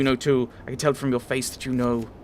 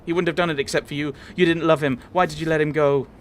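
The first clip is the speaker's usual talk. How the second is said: rising angry accusation; 4 semitones higher, 5 dB louder than usual. Acted. Voiced quarrel on the ship deck.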